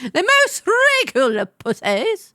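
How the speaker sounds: grand, theatrical posh British; posh British accent. Acted. Host praising the regal cat, posh British accent. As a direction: proclaim it in pompous posh British.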